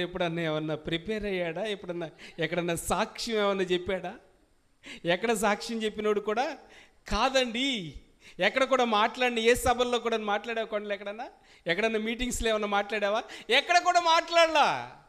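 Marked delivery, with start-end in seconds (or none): 5.0-15.0 loud, higher preacher's build, slowing on the last line; fiery preaching. Acted. Pastor challenges the congregation about the man's testimony, full-force preaching.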